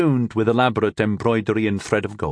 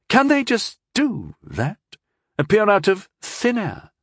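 put-on drunk demanding; 11 semitones higher than usual. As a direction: press the question a little drunk. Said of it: "she demanded a little drunkenly".

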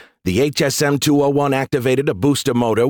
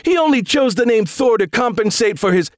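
high, whiny jeer; petulant, high-voiced gossip; sore-loser taunt; 11 semitones higher than usual. A narrator's reading. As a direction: jeer in a pitched-up voice. Sore losers mocking Thor.

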